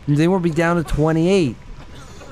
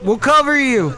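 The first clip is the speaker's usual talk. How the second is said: urgent battle call; 8 semitones higher, 3 dB louder than usual. Acted. Calling out during gunfight.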